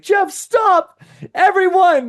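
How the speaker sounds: high, loud mock outcry; mock protest shout. Listener cuts off the coach, high hammed-up shout. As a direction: yell it like an overwhelmed listener begging him to stop. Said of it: "Some of you might be like".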